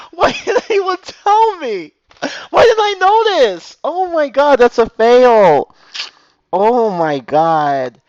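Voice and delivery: high, loud, slow comic wailing; anguished wail. Real, not acted. Streamer despairing over his wasted tutorial, wailing.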